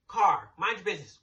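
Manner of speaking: normal talking voice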